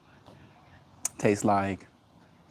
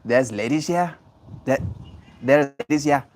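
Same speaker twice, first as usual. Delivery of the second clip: mock Yiddish accent; 4 semitones higher than usual. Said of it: "you have to say it with a strong Yiddish accent".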